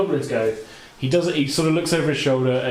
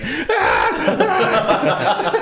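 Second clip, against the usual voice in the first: high squealing goblin shriek, laughing through it; mock a burning goblin's wail, way up high; goblin scream; 3 dB louder than usual. Game master playing the burning goblin, a high laughing shriek. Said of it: "the other goblin going".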